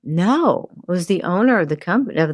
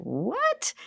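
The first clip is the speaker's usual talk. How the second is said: much higher, softer stunned what; 12 semitones higher, 10 dB quieter than usual. Retold or imitated. Reacting to the surprise job offer, high soft disbelief. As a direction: breathe it out in disbelief.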